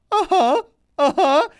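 high, rising mock uh-huh; mock agreement. Mimics two officials' nodding response.

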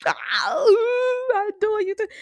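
high, teary mock reply. [name] tearfully answering [name], high and choked. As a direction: answer high with a little cry in it. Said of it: "B was like".

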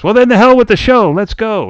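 high, loud host throwing the show aside; Bobby Van. Retold or imitated. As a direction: imitate an eager emcee calling it off. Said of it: "then Bobby Van is talking to this woman".

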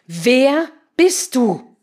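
very slow, loud, high question; emphatic demand. Acted. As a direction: hammer out each word separately.

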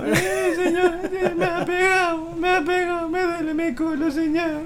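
very high, hammed-up wail; squealing mock sob, stretched out like a child tattling; fake crying. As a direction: badly fake a tearful complaint. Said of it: "echándome agüita al ojo".

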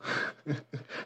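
breathy stifled laugh. Real, not acted. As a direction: hold back a snicker. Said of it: "Você tá rindo".

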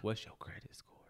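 unvoiced whisper into the mic; breathy prank whisper; giggly prank whisper. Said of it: "Let's whisper into the mic".

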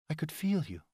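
high, quiet woman's voice; soft high female voice. Acted. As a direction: say it softly as the woman. Heroine's tender line to lover.

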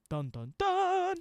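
mock suspense "dun, dun, dun"; dramatic sting. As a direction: sing out a slow, high-pitched cliffhanger sting.

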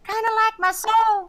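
hammed-up gloomy punchline; mock dramatic. Co-host joking about his empty soul, hammed-up. Dark joke in put-on voice.

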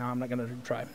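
ordinary talk

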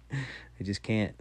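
slow, laughing give-up. Real, not acted. Comedian laughing at his aching back, slow and amused. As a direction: laugh it off, defeated.

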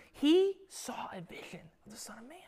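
whispered in reverent awe; awed whisper. Real, not acted. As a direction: hush it like a secret of awe. Awed hush over Christ's vision.

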